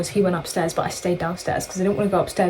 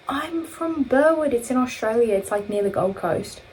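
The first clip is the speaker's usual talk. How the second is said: put-on Australian accent; 4 semitones higher than usual. Tricking strangers about where she's from, Australian accent. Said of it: "we tricked them that we were Australian".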